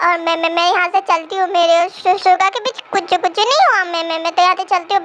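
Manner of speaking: fast stammering retreat; nervous exit. Acted. Jimina flees the conversation, fast nervous stammer. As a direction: babble your way out the door.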